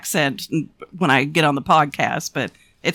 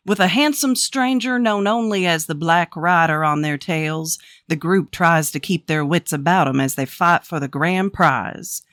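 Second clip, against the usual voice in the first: put-on cowboy accent. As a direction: play a folksy Old West cowboy narrator. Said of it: "I affect this, uh, accent".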